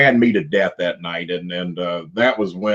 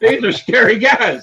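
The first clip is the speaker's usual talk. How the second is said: high, loud mock fright; comic scared yelp of words; retold alarm; 11 semitones higher, 5 dB louder than usual. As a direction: play up your younger self's alarm for laughs. Retold fearful self at ringside.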